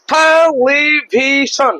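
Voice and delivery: Television slowly stretched, each syllable long; teacher's slow, connected te-li-vi-shon in television; drawn-out syllables. Shown as a demonstration. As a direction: draw out every syllable of television. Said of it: "ভাগ করে, ভাগ করে আমরা পড়তে পারবো".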